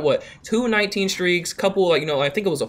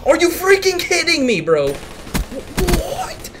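exasperated yell; 7 semitones higher, slower than usual. Real, not acted. Gamer frustrated at lag.

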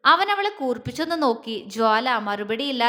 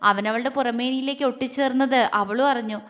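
ordinary delivery, nothing marked

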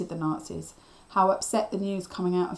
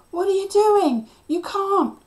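high, loud alarmed shout; panicked cry; 13 semitones higher, 9 dB louder than usual. A narrator's reading. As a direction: cry out to stop someone in danger. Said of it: "Cliff cried out".

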